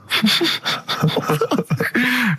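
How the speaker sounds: a burst of high-pitched laughter. Real, not acted. Host laughs at the joke.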